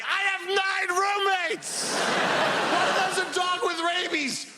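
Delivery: hammed-up frantic millennial; whiny millennial. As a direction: wail it like a desperate young renter. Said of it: "every young person's like".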